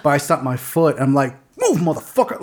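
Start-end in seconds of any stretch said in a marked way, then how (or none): 1.6-2.4 high-pitched command at contained volume; held-back shout. Shown as a demonstration. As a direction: demonstrate a shout without real loudness. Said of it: "it's gonna be super loud".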